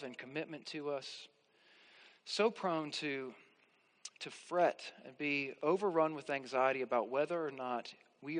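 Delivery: soft, quiet prayer voice; closing prayer. Acted. Pastor leading the congregation in closing prayer, soft and humble. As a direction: pray humbly and gently to close.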